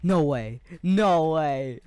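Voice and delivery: loud, stunned no way; can't believe what just happened; excited disbelief. Real, not acted. Gamer shouting at the screen in disbelief, loud.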